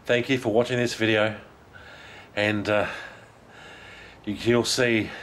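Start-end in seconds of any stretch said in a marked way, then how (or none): none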